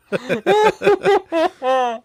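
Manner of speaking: high, loud burst of laughter; loud laughing. Real, not acted.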